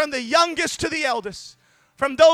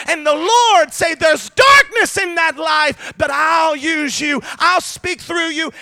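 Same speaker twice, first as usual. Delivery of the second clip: loud, driving preacher cadence, rising in pitch; a revival preacher building to a climax; full-force preaching; 3 semitones higher, 6 dB louder than usual. Acted.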